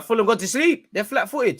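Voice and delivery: high, loud fan outburst; excited high call. Real, not acted. Fan jeering the opponents, high and excited. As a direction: yelp it out, pitch shooting up.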